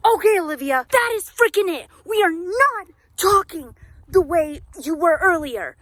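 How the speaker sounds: high, driven pep talk to yourself; driven, fired-up voice. Shown as a demonstration. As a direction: pump yourself up like a coach. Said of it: "start talking like it, like this".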